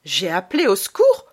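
indignant echo; outraged mother. Acted. Mother denying boy's accusation, outraged. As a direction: throw the question back.

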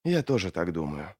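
low, soft, tired reply; weary agreement. Acted. Арди answers Глокта at the threshold, wearily soft and low. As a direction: agree wearily, voice dropped and subdued. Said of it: "Она устало кивнула".

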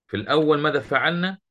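normal talking voice

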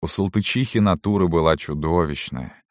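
ordinary delivery

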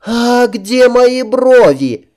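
high, slow shocked princess voice; cartoon princess. A narrator's reading. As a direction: wail like a spoiled princess. Princess finds missing eyebrows.

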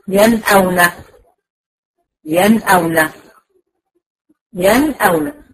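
ينأون recited cleanly, the nun sounded at once; clear recitation demo. Shown as a demonstration. Teacher modelling ينأون for her students, clear recitation. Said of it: "مش هقطع فيها الصوت ولا هطول فيها زمن".

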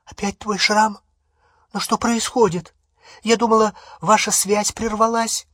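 high, anxious questioning; frightened voice. A narrator's reading. Hermione asking about Harry's scar, frightened. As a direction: ask in alarm, voice pitched right up. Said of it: "испуганным голосом спросила".

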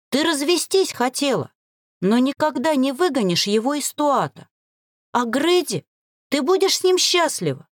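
high, insistent persuasion; heated, high-pitched appeal; fervent pleading. A narrator's reading. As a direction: argue urgently to convince. Traitor keeps pleading her case.

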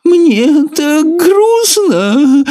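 very high, slow whimpering snail. A narrator's reading. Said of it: "захныкала улитка".

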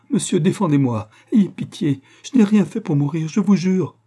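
high, loud frantic pleading; desperate cry. A narrator's reading. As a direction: beg desperately for your life. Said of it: "cria la malheureuse femme". (supplication criée, désespérée)

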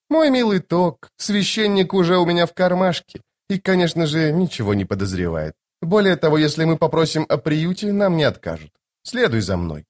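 high-pitched lady, dropping at phrase ends; playfully confident noblewoman, voice lifted high; self-assured sorceress. Acted. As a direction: speak as a lofty, teasing enchantress. Voices Lady Envy in dialogue.

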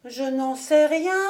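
lightly voiced helpless child; child's answer. A narrator's reading. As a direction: answer like a cornered little boy.

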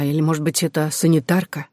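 ordinary delivery, nothing marked

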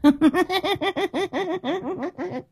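long, very high witchy cackle; crazed cackle. A narrator's reading. Grandmother threatening to eat the girl, high cackling. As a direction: let out a shrill, prolonged cackle.

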